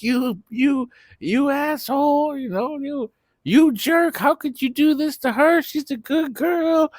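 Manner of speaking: very high mock scolding voice; party crowd scolding. Partygoers scolding Breeze for cheating, high mock voice. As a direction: squeak out the crowd's outrage.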